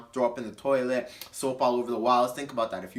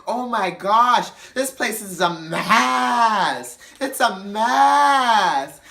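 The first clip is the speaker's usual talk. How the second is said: high, loud, very slow moaning; hammed-up drunk complaint; drunk dramatic; 7 semitones higher, 7 dB louder, much slower than usual. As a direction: stretch out every word. Imitating drunk Donette complaining.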